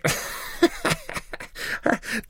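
very high wheezy giggle; drunken laugh. Acted. Tipsy Maruf laughs at the vizier, drunken laugh. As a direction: cackle helplessly, very high.

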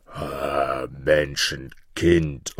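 very drawn-out low dragon call. A narrator's reading. Nine-headed dragon meets boy in forest, low slow menace. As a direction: loom over the boy with long low vowels. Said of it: "rief dieser".

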